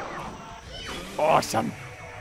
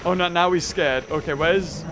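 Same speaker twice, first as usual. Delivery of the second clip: ordinary talk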